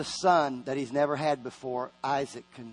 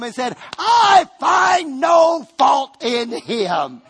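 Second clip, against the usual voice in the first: rising to a shouted climax; full-force preaching; 9 semitones higher, 11 dB louder than usual. Acted. Preacher building to a shout before the congregation. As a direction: preach it louder and louder until you shout.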